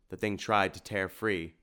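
ordinary delivery

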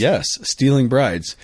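Yes drawn out slowly, stealing brides stressed; emphatic yes. Real, not acted. Emphatic confirmation.

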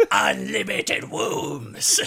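very slow, drawn-out villain proclamation; Emperor Palpatine. Acted. Co-host echoing the Star Wars joke, drawn-out mock villain. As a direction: savour each word like an evil emperor. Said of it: "Or Emperor Palpatine".

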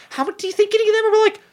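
high, fast incredulous squeal; squeaky excited. Real, not acted.

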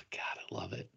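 breathy whispered delight. Real, not acted. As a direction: whisper it with glee.

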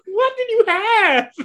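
high, loud-ish drawn-out disbelief; laughing mock incredulity. Podcaster mocking Heather's big moment, laughing incredulity. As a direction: squeal the question through giggles.

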